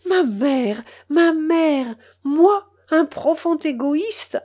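uncle, stung by his mother, crying out in despair; desperate cry. A narrator's reading. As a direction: build it into an anguished protest. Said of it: "s'écria désespérément mon oncle".

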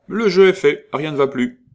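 loud, high and decisive; resolute. Acted. Guite sealing her bargain with Saladin, loud resolute call. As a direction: slam the deal shut like a croupier. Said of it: "dit résolument".

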